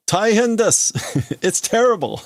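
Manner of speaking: high, urgent hammed-up report; mock alarm. Crewman reporting trouble to the admiral, mock alarmed. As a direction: play panic, half laughing.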